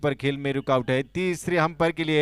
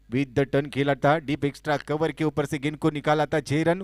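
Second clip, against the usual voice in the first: ordinary delivery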